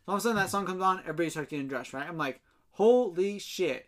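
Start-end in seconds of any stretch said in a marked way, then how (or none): 2.7-3.9 slow, drawn-out astonishment; hammed-up stunned reaction, very slow; retold amazement. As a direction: stretch each word in mock awe. Retelling his shock at people undressing.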